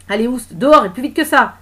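forceful, impatient shout; barked order. Acted. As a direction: shove her out with your voice. Policeman throws her out.

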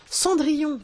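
high, delighted exclamation; light up with recognition, voice leaping up; eager girlish guess, pitched high; enthusiastic. A narrator's reading. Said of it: "fit Wendy enthousiaste".

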